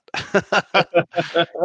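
loud high laugh. Real, not acted. Laughs at chat joke.

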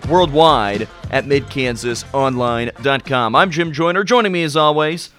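big, loud show-opening hype; radio announcer. Acted. Radio host opens the show.

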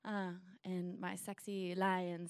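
ordinary talk

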